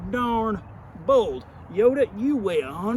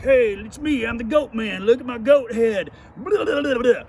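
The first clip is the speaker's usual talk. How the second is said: goat man; 3 semitones higher, 6 dB louder than usual. Retold or imitated. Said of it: "the goat man was like".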